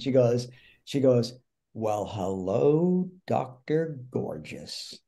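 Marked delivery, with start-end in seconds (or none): 1.7-5.0 slow, drawn-out mock swooning; flirty fan greeting. Fan with microphone greets the actor on stage, slow mock flirtation. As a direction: savour each word like an admiring fan, slowly. Imitating an admiring fan's greeting.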